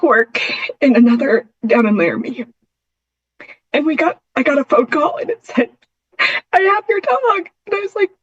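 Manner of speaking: fighting back tears while telling it; intermittent tremor. Real, not acted. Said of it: "sorry, I get emotional".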